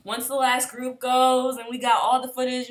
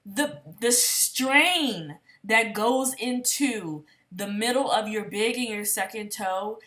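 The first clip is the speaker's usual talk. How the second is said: ordinary talk, nothing marked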